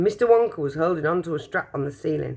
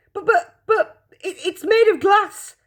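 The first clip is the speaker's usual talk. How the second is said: much higher, louder stammering; panicked shout; 6 dB louder than usual. Acted. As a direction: stammer in alarm. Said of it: "shouted Grandpa Joe".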